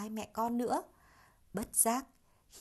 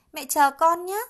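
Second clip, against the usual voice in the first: urgent promise; 8 semitones higher, 13 dB louder than usual. A narrator's reading. Child promises to return.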